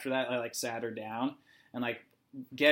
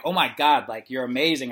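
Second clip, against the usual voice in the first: louder, excited cheering-on; proud praise; 8 dB louder than usual. Retold or imitated. Therapist praising patient who moved her leg, excited. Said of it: "like, so proud".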